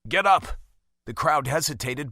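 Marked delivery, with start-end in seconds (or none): 0.1-0.6 loud, higher order; commanding shout. A narrator's reading. Caspian ordering the kneeling crowd up, loud command. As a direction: bark it at the crowd.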